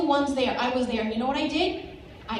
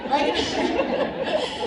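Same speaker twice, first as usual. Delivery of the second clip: high squeaky laugh through the word; a real giggle on the word; 10 semitones higher than usual. Real, not acted. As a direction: laugh it out, amused at herself. Laughing at her own joke.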